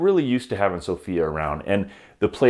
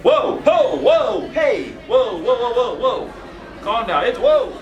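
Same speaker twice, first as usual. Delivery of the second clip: alarmed shouting; 14 semitones higher, 4 dB louder, slower than usual. Pretend. Startled by rowdy pack inside.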